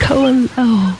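high soft coo that settles down on "Koen"; cooing softly, falling. Acted. Xie recognizing her lover, cooing softly. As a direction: let "Koen Lo" fall gently, like a sigh. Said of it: "cooed Xie softly".

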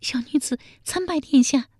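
high-pitched, frightened maid voice; frightened palace maid. A narrator's reading. Palace maid kneeling before the emperor, frightened. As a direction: play a scared young woman, pitched well up. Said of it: "一脸惊恐地说".

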